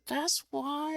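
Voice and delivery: high-pitched, fed-up; high exasperated. Retold or imitated. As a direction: squeal your frustration. Venting to coworker about LA.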